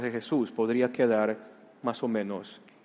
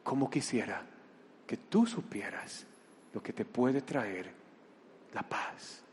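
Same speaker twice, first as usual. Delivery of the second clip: tender, soft plea; 8 dB quieter than usual. Acted. Jesús repite con ternura.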